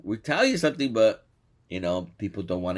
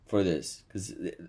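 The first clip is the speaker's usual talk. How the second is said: ordinary delivery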